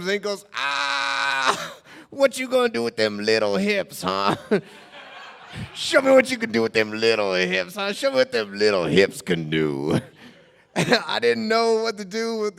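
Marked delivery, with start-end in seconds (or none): 0.5-10.0 teasing homeless man. Retold or imitated.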